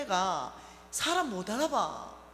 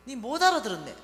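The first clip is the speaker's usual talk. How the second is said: mother's rebuke, louder; mother repeating; 2 semitones higher, 9 dB louder than usual. Retold or imitated. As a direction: insist like a stubborn mother. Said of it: "계속 반복되는 거예요".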